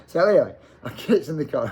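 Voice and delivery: ordinary talk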